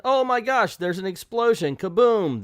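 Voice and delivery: high, loud fake shock; hammed-up gasp of a sudden disaster; mock alarm. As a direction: exclaim it in mock panic. Mocking the comic's sudden explosion.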